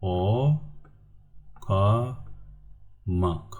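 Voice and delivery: "O", "ka", "mak" with pauses between; syllable by syllable. Shown as a demonstration. Teacher gives students the answer, "O, ka, mak" in separate syllables. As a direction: stretch "O" and "ka", end on "mak". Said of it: "The word okumuk".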